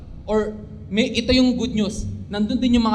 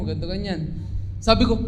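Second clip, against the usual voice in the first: usual speaking voice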